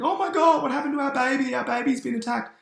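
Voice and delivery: hammed-up high, loud falsetto panic; panicked parents. Parents finding their scratched baby, mock high-pitched panic. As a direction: squeal it as mock hysterical parents.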